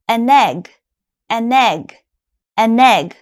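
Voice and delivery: "an egg" said as "anegg", n carried onto egg; linked as one word. Shown as a demonstration. Teacher drilling linking for students, joined. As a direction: blend "An egg" into a single word. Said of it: "it sounds like one word".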